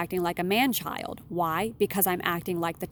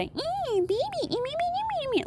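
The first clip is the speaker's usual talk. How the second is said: put-on very high, whiny baby babble; baby talk. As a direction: mimic a grown man babbling like a baby. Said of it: "like, baby talk".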